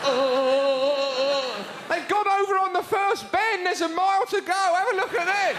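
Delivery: hammed-up delighted gasps; gleeful crash commentary. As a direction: revel in the pile-up. Excited mock commentary at crash.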